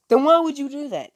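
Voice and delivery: teacher fed up with his pupil; exasperated. Retold or imitated. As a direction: scold a reckless student. Kakashi scolding Naruto.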